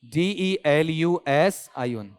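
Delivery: put-on slow, deliberate letter spelling for a child; spelling each letter slowly like teaching small kids. Shown as a demonstration. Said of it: "spelling बोलो बिना देखे".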